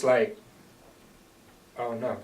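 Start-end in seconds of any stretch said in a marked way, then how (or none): 1.8-2.2 mom starting to cry. Retold or imitated. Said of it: "you could hear right away that she's starting to cry".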